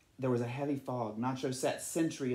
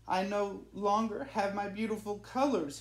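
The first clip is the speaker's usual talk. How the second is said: sad; 6 semitones higher than usual. Acted. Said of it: "he said".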